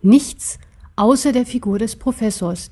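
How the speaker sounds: plain reading voice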